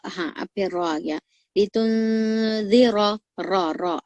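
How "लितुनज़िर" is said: लितुनज़िर slow and louder, thick final र; slow recited लितुनज़िर; heavy r recitation. Shown as a demonstration. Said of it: "रॉ को भी आपको मोटा पढ़ने का है".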